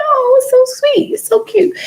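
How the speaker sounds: very high, gushing squeal over cuteness; gushing baby coo. Acted. Acting out a reaction to a baby's laugh, high cutesy cooing.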